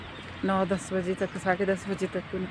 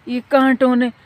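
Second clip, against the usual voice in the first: louder, higher startled cries; caught in thorns; 4 semitones higher, 7 dB louder than usual. Real, not acted. Vlogger snagged by thorns in the park, startled and loud. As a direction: yelp as thorns snag you.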